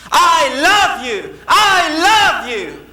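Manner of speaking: high, loud, drawn-out shout. Acted. Boy outside shouting to the unseen voice, loud calling. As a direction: call it out slowly into the distance. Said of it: "then he started shouting".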